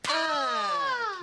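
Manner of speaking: loud, high-pitched scream; sudden scream. Acted. Man mid-task with his buddy, screams out.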